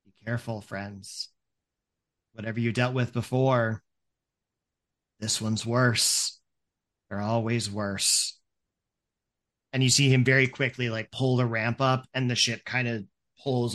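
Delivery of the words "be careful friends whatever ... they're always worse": wary ferryman. Acted. Ferryman warning friends about a fiend, wary. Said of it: "you hear the ferryman go".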